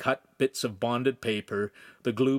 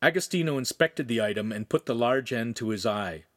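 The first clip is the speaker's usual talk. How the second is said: plain reading voice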